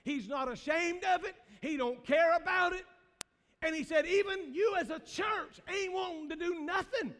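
loud, high preacher's cry; hammered-out pulpit rebuke; full-force preaching. Acted. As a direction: lift it to a rousing shout. Preaching Paul's rebuke, rising.

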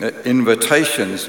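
normal talking voice

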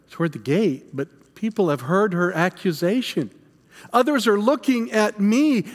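normal talking voice

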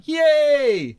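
high, lone cheer for Florida. Pretend. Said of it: "then only I cheer".

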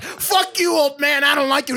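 high mock yell at the old man; retold yell. Tim Allen yelling at the old guy, mock shout. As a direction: yell it in a hammed-up rage. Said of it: "he's yelling at the old guy".

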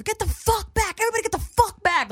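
high, loud crowd-clearing yell; retold shout. Dude runs over to push people back, shouting. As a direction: yell it high, hard on the cursing.

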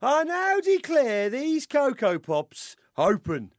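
high, loud self-important mayor voice; blustery pompous mayor. Acted. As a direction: proclaim everything like a mayor. Mayor dad in sketch.

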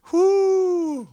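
high, excited whoop into the intro; excited whoo. Real, not acted. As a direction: shout a hyped whoo to open the show. Podcast host's hyped greeting.

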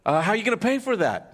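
high, quick teasing ask; fast amused question. Retold or imitated. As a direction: tease the dog about paying. Butcher asks dog playfully.

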